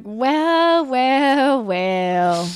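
drawn-out "well, well, well", stepping down in pitch; mock knowing. Replying to accent comments from listeners, mock knowing. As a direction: start high and loud, let each "well" drop.

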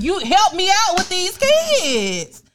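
high, very slow, whiny plea; mock pleading dad. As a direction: stretch every word like a helpless husband begging. Mocking dad asking for help.

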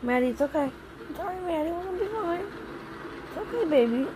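high, gentle reassurance; soothing. Real, not acted. Soothing upset child in car.

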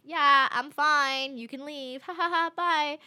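fake-calm, wants him gone; shooing the driver away with a forced laugh; nervous brush-off. Getting rid of the driver.

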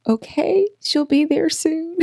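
defeated mom. Retold or imitated. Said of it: "So she's like".

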